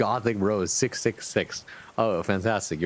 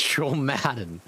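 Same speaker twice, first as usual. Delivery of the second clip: ordinary delivery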